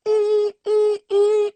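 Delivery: repeated high-pitched "ee" tones; sustained high bright "ee" sounds; high "ee" vocal sounds. Shown as a demonstration. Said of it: "if I asked you to go".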